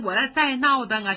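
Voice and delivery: ordinary delivery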